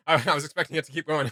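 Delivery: laughing through the words, a bit fast. Real, not acted. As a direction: say it while still giggling. Laughing at the cut-off quote.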